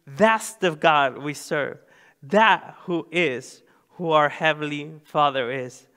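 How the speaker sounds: usual speaking voice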